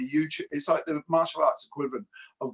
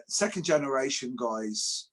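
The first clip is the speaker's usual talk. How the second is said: ordinary delivery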